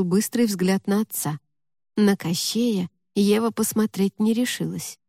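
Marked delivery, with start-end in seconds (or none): none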